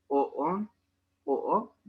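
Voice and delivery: "o on, o o" with each vowel re-attacked, jumping up; rearticulated vowel. Shown as a demonstration. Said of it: "va a ser así el sonido". ("o on, o o" con la vocal rearticulada, subiendo)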